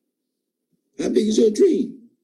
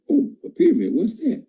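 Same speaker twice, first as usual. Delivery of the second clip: higher, pointed mock-innocent question; mock aggressive; 9 semitones higher than usual. A networker mocking a doubtful prospect, in mock-aggressive innocence. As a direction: play dumb to push back at the prospect. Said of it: "If I wanna get aggressive".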